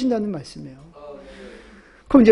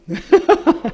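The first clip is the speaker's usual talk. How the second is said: short laugh; 5 semitones higher than usual. Real, not acted. Preacher laughs at own joke.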